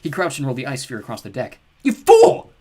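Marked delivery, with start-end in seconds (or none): 1.8-2.5 very high, very loud shriek; furious yell. Acted. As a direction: scream it at him in rage. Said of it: "Coyote yelled".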